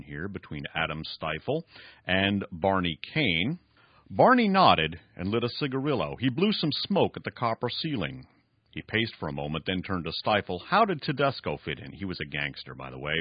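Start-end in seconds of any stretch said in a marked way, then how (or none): 4.1-11.9 raised, emphatic story reading; dramatic novel reading. Acted. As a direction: read the villain's boast with drama. Reads novel passage aloud.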